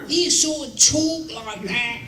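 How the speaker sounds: mocking Cockney whine from an old radio record; H G Wells Cockney whine. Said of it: "his voice was a high-pitched Cockney whine".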